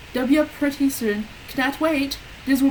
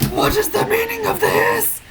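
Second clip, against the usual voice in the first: put-on high angry old wizard; 11 semitones higher, 4 dB louder than usual. A narrator's reading. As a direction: demand it angrily, pitched up. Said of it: "Dumbledore asked all angrily".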